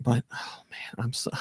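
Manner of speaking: breathy whispered awe. Real, not acted. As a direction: whisper it in disbelief. Overwhelmed remembering the win.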